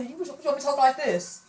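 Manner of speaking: high-pitched voice. Shown as a demonstration. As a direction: show off the high work voice on purpose. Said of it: "Yeah, I get high pitched".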